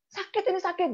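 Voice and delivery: high, whimpered imitation of pain; imitated pained complaint. Client answers visiting agent about her pain, imitated high complaint.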